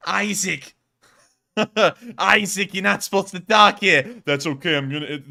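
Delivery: Irish accent, loud, high and hammed-up; mock Irish. Shouting at Isaac in mock Irish, loud. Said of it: "speaking like I'm Irish".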